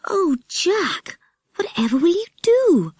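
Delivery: put-on a girl frightened for her friend; dismayed girl. Nora cries out to Jack in alarm.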